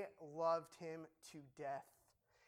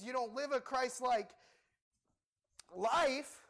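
loud, raised-pitch sermon voice; preaching at full force; 4 semitones higher, 4 dB louder than usual. Acted. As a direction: drive Paul's charge home to the congregation. Preaching Paul's words loudly.

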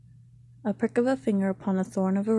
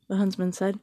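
plain reading voice

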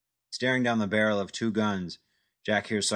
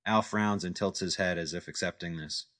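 ordinary delivery

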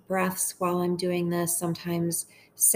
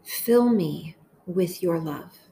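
slow, measured meditation voice with long pauses; unhurried, deliberate phrases for listeners to echo; guided prayer meditation; slower than usual. Acted. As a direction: pray each short phrase slowly, leaving space to repeat it.

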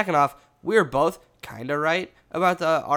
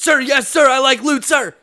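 barked army recruit replies; drill sergeant; 10 semitones higher, 10 dB louder than usual. Pretend. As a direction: shout it like a cadet. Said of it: "keep this up with this bit".

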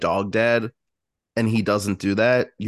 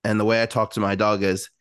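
usual speaking voice

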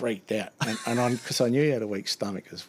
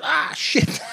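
horrified yelp; 6 dB louder than usual. Retold or imitated. Driver realizing he touched it.

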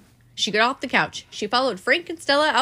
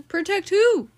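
high and louder question; higher lady voice; 9 semitones higher, 4 dB louder, slower than usual. A narrator's reading. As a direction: redo it as the anxious wife. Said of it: "said Missus, Mister Watson".

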